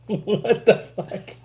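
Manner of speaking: high, slow, helpless with laughter; laughing disbelief. Real, not acted. Friend baffled by biscuit eating bulldog, laughing. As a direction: wheeze it out between laughs. Still cracking up at the insult.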